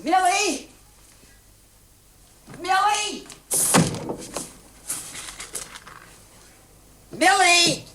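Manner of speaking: calling a name through the house. Acted. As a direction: call upstairs for the daughter.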